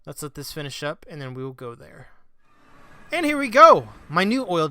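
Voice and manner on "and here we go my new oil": much louder, higher triumphant call; big hype reveal shout; excited announcement. Real, not acted. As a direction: announce the arrival with gusto. Arriving at new dimension.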